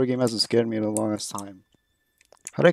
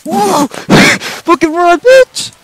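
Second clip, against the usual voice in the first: high panicked screams while laughing; panicked screaming; 12 dB louder, much slower than usual. Real, not acted. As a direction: shriek in terror at the chase. Chased by nun in game.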